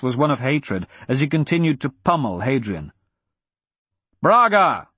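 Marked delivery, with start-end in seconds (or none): 4.2-4.9 loud, high shout with a falling end; Ulrich, shouting. A narrator's reading. Ulrich to Braga from the hall's far end, shouting. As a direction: shout the name down a long hall. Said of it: "Ulrich shouted from the far end".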